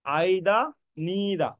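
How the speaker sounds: "either" as EYE-da, "neither" as NEE-da; British pronunciation. Shown as a demonstration. Teacher drilling students so they never err, "either, neither" as EYE-da, NEE-da. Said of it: "এই উচ্চারণ".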